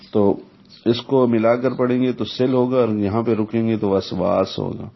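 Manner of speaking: normal talking voice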